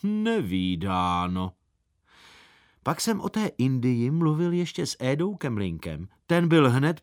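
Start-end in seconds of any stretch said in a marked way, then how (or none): 0.0-1.5 low, slow and drawn-out disdain; unimpressed elephant, word stretched long; scornful. A narrator's reading. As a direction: draw it out in lofty dismissal. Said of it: "Jumbo ohrnul pysk".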